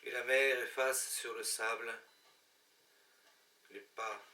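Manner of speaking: hushed, wistful poetry reading with long pauses; measured, melancholy recital; poem recitation. Acted. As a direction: recite a lost-love poem line by line, letting endings fade. Recites Les Feuilles mortes as a poem.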